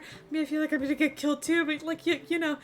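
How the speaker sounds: nervous man agreeing loudly while whispering is needed; panicked agreement. Acted. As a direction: blurt it out, scared for his life. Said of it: "He's like".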